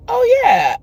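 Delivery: sudden realization. Real, not acted. Sudden realization mid-sentence.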